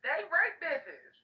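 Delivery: loud provocative rant; shouting. Real, not acted. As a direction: yell it out to provoke.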